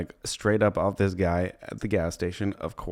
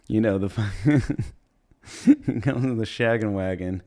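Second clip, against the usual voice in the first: ordinary delivery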